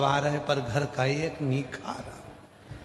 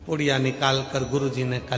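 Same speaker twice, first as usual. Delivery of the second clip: ordinary talk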